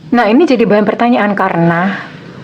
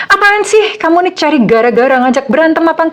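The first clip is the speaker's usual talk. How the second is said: snappy retort pitched much higher, mock irritation; imitating angry partner; 8 semitones higher than usual. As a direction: play the annoyed partner lashing back. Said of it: "dia malah muring-muring, malah marah-marah". (menirukan pasangan yang marah-marah dengan nada tinggi)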